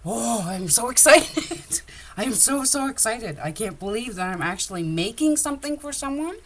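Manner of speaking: high, giddy burst of excitement with a laugh; thrilled squeal. Retold or imitated. Reliving her first custom order, squealing with excitement. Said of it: "And, uh, like".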